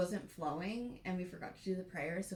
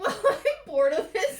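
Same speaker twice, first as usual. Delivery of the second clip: much higher and louder, laughing out the line; laughing quote; 11 semitones higher, 17 dB louder than usual. Retold or imitated. Quoting her own boredom while laughing hard. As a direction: squeal it through laughter. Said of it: "I'm like".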